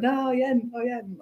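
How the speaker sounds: lightly voiced drunken protest; mock drunk. Imitating a drunk guest told to get out of bed, mock drunk. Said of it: "like, drunk".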